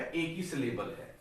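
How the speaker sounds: ordinary delivery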